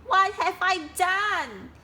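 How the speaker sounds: high, loud slow despair; panicked. Acted. Duck realising his mistake, loud wail. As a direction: cry out in regret.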